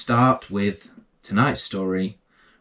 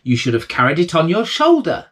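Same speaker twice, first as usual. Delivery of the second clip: higher, louder mother's scold; a hint of an exasperated mother; 7 semitones higher, 5 dB louder than usual. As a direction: tell him off like his mum. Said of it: "said his mother".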